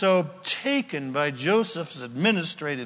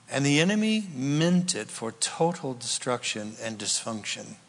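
prayer; 4 semitones lower than usual. Acted. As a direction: pray gently over the hurting. Prays over congregation.

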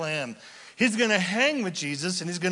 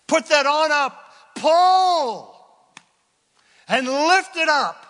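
much higher and louder, ringing out; preaching shout; 11 semitones higher, 10 dB louder than usual. Real, not acted. Preacher proclaiming the snake on the pole, full-voiced shout. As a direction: proclaim it to the back row.

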